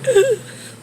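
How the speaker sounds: high, short gasp; retold gasp. Mother reacting to the plane aiming for a cross, imitated gasp.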